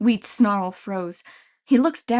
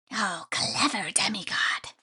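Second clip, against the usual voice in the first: sinister whispering creature voice; creepy grain spirit whisper; 6 dB quieter, slower than usual. Acted.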